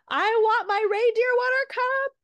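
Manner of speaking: put-on high, loud, drawn-out toddler wail; whining toddler demand. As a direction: imitate a small child wailing a demand. Mother voices upset child demanding cup.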